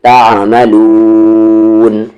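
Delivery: तामलून with the final noon held long and nasal; drawn-out nasal noon. Shown as a demonstration. Teacher stretching the noon so students hear it, slow. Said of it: "नून में मिला देंगे खींचते हुए".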